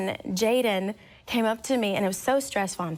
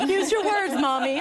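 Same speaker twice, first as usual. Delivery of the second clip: put-on high little-girl voice correcting mom; imitated toddler; 6 semitones higher than usual. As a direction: chide mom in a small child's voice.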